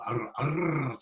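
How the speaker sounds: "R, R" rolled with the tongue tip; trilled R sound. Shown as a demonstration. Lecturer isolating the trilled R for students. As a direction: roll "R, R" like in Scotland.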